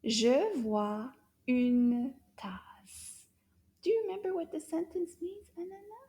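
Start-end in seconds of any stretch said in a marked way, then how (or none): 0.1-3.2 slow model. Shown as a demonstration. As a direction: draw out Je vois une tasse. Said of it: "say the whole thing together".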